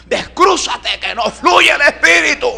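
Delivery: very high, loud imitated scream; sudden high-pitched yelled order; shouted command from behind. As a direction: shriek it like the medium, hammed-up. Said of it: "siento una voz por detrás que me dice así".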